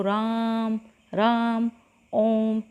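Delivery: long stretched vowel as if calling someone far away; drawn-out call. Shown as a demonstration. Teacher calls to a student far off, vowel held long. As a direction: call the name out slowly across a distance. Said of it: "उसको हम कैसे बुलाएंगे".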